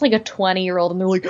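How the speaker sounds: normal talking voice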